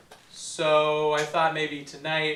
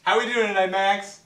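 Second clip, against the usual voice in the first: loud, higher host call to the band; louder and higher call to the bandleader; 5 semitones higher, 6 dB louder than usual. Acted. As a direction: shout across the studio to Max.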